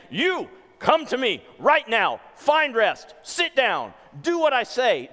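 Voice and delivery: loud, high, forceful orders; demonstrative command. Acted. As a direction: order them about, each phrase punched. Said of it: "demonstratively saying".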